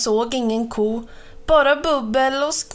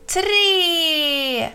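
high, drawn-out count before the leap; excited high call to launch a jump; 7 semitones higher than usual. A narrator's reading. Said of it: "ropade Mamma Mu".

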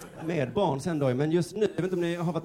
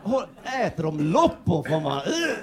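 higher, gasping with disgust and wonder; excited commentator; 5 semitones higher than usual. Retold or imitated. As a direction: react like seeing it for the first time. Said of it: "de skulle vara så att de bara". (högt, förundrad och äcklad kommentator)

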